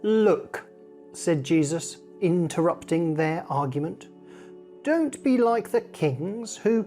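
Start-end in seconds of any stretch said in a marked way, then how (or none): none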